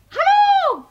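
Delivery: very high, loud cheerful call; loud, high hello. Acted. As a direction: shout a bright greeting into the kitchen. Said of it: "rief Emilie Viduvilie Kantilie".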